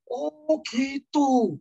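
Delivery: long, high cry of realization; imitated sudden cry. Imitating an old man suddenly understanding the gospel, crying out. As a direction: stretch it out high as a sudden revelation. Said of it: "tiba-tiba si omnya teriak".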